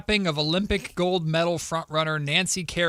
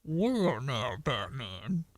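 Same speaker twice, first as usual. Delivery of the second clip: lower, soft, slow muffled drawl; Southern Bane; 6 semitones lower, 9 dB quieter, much slower than usual. Acted. Friends' dare, Southern Bane taunting Batman, muffled. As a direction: mumble it low and slow through a mask. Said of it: "Little too muffled".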